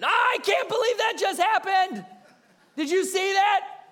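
high, loud, frantic squeal with a laugh; panicked grain of sand. Acted. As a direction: play the tiny grain shrieking in disbelief. Grain of sand voiced in demo.

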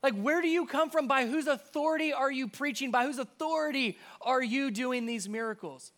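high-pitched, insistent questioning; really curious. Acted. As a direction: question him like a baffled, curious crowd. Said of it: "start to get really curious".

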